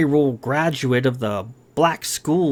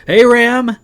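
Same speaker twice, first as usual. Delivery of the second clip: higher, loud call; 10 semitones higher, 8 dB louder than usual. A narrator's reading. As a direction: shout down the empty halls. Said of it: "he cried".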